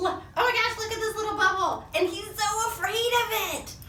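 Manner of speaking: put-on high-pitched gushing to children; excited cooing. Reader showing kids a scared little character, excited cooing.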